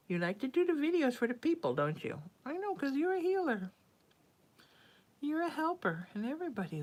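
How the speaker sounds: high cooing praise for a pet. Real, not acted. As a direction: coo up high, praising the cat. Cooing to her cat.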